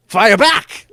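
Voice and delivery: mock shout. Comic acting out ship firing.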